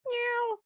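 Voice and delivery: high mock meow of a kitten; playful imitated meow; cat meow. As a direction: squeak out a cat's meow. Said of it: "It sounded like this".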